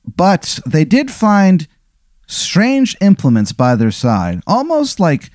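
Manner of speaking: slow, high-pitched teasing reveal; stretched-out mock-mystery storytelling; suspenseful reveal. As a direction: draw out each clue with long, lifted words. Suspenseful reveal in improvised tale.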